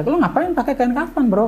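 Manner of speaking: high, disbelieving ask to a friend; retold alarm, pitched well up; incredulous question. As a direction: ask him, baffled, why he's wearing a shroud. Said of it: "kata saya".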